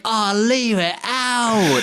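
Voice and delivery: slow, high, drawn-out mock Cockney; Basher Tar. Fan mimicking the line when the alarm goes out, exaggerated Cockney. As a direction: stretch it out in hammy Cockney. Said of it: "the way he's like".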